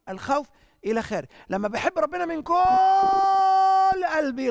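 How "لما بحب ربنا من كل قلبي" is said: high, slow preaching cry, stretched out; a soaring impassioned cry of devotion; fervent shout. Real, not acted. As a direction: call it out with full heart, drawn long. Preacher cries out about loving God.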